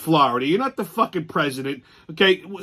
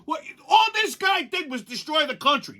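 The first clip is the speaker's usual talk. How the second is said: normal talking voice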